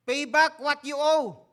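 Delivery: loud, high-pitched demand for payment; forceful demand. Acted. Unforgiving slave to his fellow slave, forceful loud demand. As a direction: raise your voice and demand the debt.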